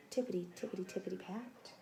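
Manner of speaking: soft, sneaky tippity steps; hushed bunny footsteps; hushed tiptoe. A narrator's reading. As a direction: tiptoe the words like sneaking bunnies.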